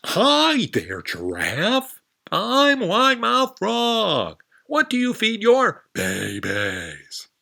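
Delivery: very high, loud, slow frog greeting; a big-mouthed frog shouting hello; wide mouth frog voice. Acted. Frog introducing herself to the lizard, high loud voice.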